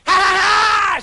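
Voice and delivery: very high, loud witch cackle; the witch screeches at the prince; screeching cackle. Acted. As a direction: screech it like a wicked hag. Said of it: "she screeched".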